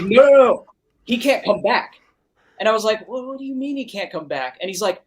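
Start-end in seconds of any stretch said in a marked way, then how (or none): 0.0-1.9 urgent higher, louder retelling; alarmed trainer. Retold or imitated. Trainer warning the recordist his colleague can't return, mock alarm. 3.1-4.6 high-pitched, incredulous retold question; baffled disbelief. Recordist questioning the trainer's warning, acted disbelief.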